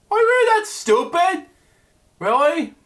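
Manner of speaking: mock the waiter's stupidity; exasperated. Real, not acted. Reader scolding the waiter, high exasperated.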